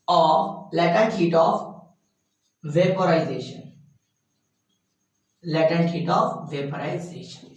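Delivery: very slow, each word set apart, trailing off; slow dictation. Shown as a demonstration. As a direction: dictate the term slowly to the class. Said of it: "क्या बोलते हैं बोलो?".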